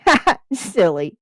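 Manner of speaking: mock cackling laugh. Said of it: "Jax cackled".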